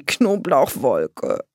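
slow, drawn-out complaint; voiced as a grossed-out girl; disgusted exclamation. A narrator's reading. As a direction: stretch the word in put-on disgust. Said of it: "rief sie".

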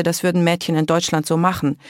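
usual speaking voice

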